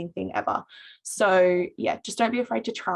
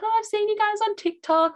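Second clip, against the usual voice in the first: much higher, starstruck retold gush; starstruck girl; 14 semitones higher, 3 dB louder than usual. Girl telling students she saw them on TikTok, high starstruck gush. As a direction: imitate the girl like she met a celebrity. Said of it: "She's like".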